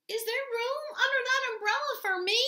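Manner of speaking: very high, tiny creature voice; small mole voice. A narrator's reading. Mole asks to share the umbrella, small high voice. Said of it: "came Mole's small voice from nearby".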